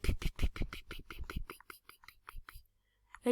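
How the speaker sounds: unvoiced whispered gun sounds; whispered pew pews. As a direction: breathe the pews softly. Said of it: "and they're like".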